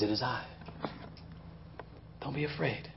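calm the frightened disciples softly; soft reassurance. Retold or imitated. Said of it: "and Jesus comes in and says".

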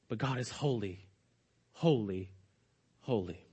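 very low, soft, separated "holy"s; solemn hushed repetition. Real, not acted. Threefold holy again, as climax.

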